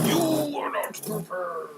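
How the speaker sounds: dramatic villain line. Acted. Repeating quote dramatically.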